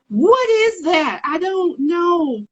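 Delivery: high, drawn-out imitation of bafflement; slow, high-pitched mock confusion. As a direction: stretch it out high, acting utterly puzzled. Acted baffled reaction to a remark.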